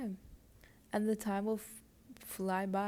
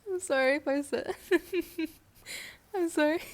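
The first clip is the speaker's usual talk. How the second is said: sorry said through giggles, higher and louder; laughing apology; 8 semitones higher, 6 dB louder than usual. Real, not acted. Embarrassed by how she said calm down, laughing. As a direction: apologize while still laughing. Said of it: "I'm just laughing".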